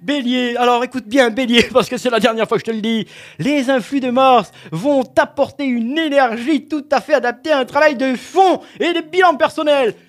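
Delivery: loud, fast pompous forecast; hammed-up oracle delivery; mock stern horoscope. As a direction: lay down the law like a TV astrologer. Mock-authoritative horoscope reading.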